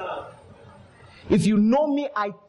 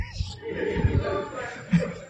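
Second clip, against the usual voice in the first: high laugh; 4 dB quieter than usual. Real, not acted. Preacher laughs at own story.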